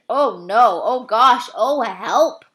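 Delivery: put-on girl tasting something horrid; loud disgust. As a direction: react to the awful taste. Sophie tasting the snozzcumber.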